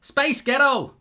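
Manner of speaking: high, exaggerated American accent. Shown as a demonstration. Host showing it sounds like Scottish, American accent. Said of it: "say it American".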